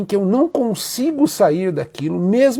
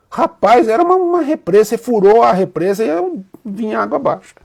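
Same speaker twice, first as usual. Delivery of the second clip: emotional outburst; 4 semitones higher, 6 dB louder than usual. Retold or imitated. Friend describes tears bursting out.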